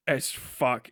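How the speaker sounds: ordinary talk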